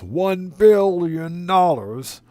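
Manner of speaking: slow, high villain drawl, savoring it; pinky to lips, evil mastermind boast; Doctor Evil voice. Pretend. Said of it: "In our Doctor Evil voice".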